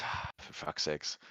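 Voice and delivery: soft, slow, sighing grumble; exasperated sigh. Acted. Nat reluctantly agreeing to climb the ladder, weary sigh. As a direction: sigh it out under your breath. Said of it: "lets out a sigh and goes".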